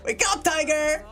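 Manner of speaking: high, bright call to wake her; playful shout. Acted. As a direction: yell it like a rowdy student. Students shouting teacher's nickname.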